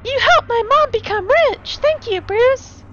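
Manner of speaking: high put-on female voice; high sweet voice of a thankful mom; mom character voice.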